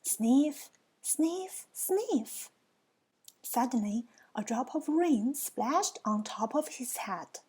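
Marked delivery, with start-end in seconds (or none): 0.0-2.5 three sniffs, each pitched higher; sniff, sniff, sniff, climbing in pitch each time; imitated sniffing. A narrator's reading. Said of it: "A sort of sniffing noise".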